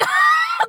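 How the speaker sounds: sudden high squeal of a laugh; high incredulous laugh. Retold or imitated. The brothers stunned by Gwen, high laugh. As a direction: burst out in a shocked giggle. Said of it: "they're just like".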